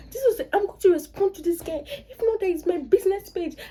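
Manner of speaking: high-pitched mock indignation; fuming and vexing. Business owner insists on responding to a rude commenter, mock fuming. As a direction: imitate a vexed woman insisting on answering back. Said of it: "she was fuming and vexing".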